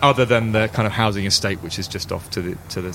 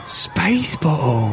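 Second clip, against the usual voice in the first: slow, loud, high-pitched marvelling; awed young girl; 10 semitones higher, 4 dB louder, much slower than usual. Acted. Girl sees a tin water bottle, awed kid voice. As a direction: draw it out high, like an amazed kid. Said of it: "She looks, she's like".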